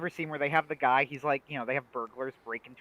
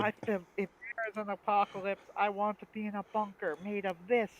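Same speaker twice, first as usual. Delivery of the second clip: high, emphatic awed announcer; mock sports commentator; 6 semitones higher than usual. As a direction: marvel like a match commentator. Acts sketch's sports commentators.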